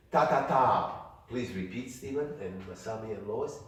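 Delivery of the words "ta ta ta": three crisp taps of ta; teacher tapping out a rhythm; rhythm demo. Shown as a demonstration. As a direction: clap out ta ta ta evenly. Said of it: "Repeat after me".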